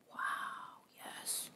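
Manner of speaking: hushed, whispered amazement; very soft, voiceless marvel; awed whisper. Real, not acted. Awed by highlighter just applied.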